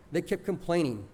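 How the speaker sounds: usual speaking voice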